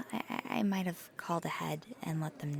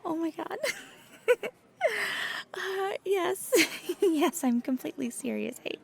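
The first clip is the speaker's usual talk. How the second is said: laughing; 11 semitones higher than usual. Real, not acted. Laughs at listener's disbelief.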